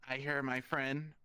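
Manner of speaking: ordinary talk, nothing marked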